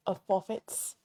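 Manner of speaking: ordinary talk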